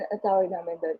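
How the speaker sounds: ordinary talk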